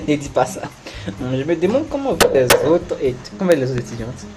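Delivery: ordinary talk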